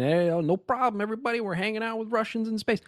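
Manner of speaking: high mock-cheerful voice; sing-song fake friendliness; sarcastic cheer. Mocking the official friendly story.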